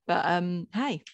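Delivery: normal talking voice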